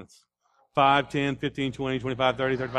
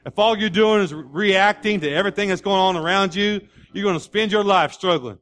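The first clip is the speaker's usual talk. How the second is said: raised, driving sermon delivery; emphatic preaching; 5 semitones higher, 6 dB louder than usual. Real, not acted. Preacher telling congregation Christianity is proactive, forceful preaching. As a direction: drive the point home to the crowd.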